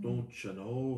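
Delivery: light Irish lilt; a casual hint of Irish brogue; Irish accent. Host doing Irish accents for the holiday, light brogue. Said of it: "I just do the Irish accents".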